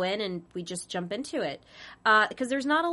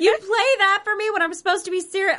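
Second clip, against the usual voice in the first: fast, laughing, high complaint; laughing protest; 12 semitones higher, 6 dB louder, faster than usual. Retold or imitated. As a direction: rush it out through laughter. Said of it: "I'm just like".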